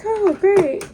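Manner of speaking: loud, high sarcastic "oh great". Retold or imitated. Dreading telling mom, loud and sarcastic. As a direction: throw it out with bitter sarcasm.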